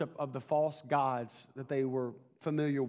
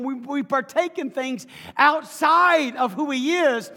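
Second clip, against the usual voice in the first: high, loud sermon delivery, building; emphatic preacher driving the point home; impassioned preaching; 8 semitones higher, 9 dB louder than usual. Real, not acted. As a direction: preach it with rising force. Preaches to congregation, building in force.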